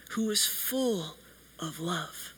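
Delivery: drops to a near-silent, slow close; fading to hush. Real, not acted. Preacher closing on God's love, fading out. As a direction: let it fall away to almost nothing.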